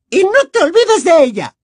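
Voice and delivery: high, loud villain command; sputtering foiled villain. Acted. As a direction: bark the order like a petty tyrant. Umbridge orders.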